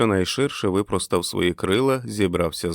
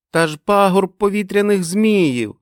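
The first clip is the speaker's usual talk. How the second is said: put-on high, loud childish cry; high-pitched collective exclamation; children's chorus shout; 12 semitones higher, 6 dB louder than usual. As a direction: shout it like a gang of excited kids. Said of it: "закричали вони хором".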